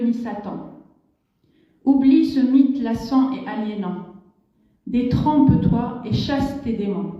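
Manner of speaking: emphatic poetic declamation in French; rousing call to revolt, read as verse; poem recital. Acted. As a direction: declaim each line firmly, pausing after it. Reads poem's French version.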